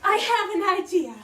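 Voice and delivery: gasp, then loud excited announcement; a queen struck by inspiration; sudden idea. Acted. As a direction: burst out with the idea.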